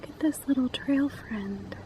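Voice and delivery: soft unvoiced whisper to a critter. Real, not acted. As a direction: whisper so you don't scare it. Quiet aside to small animal.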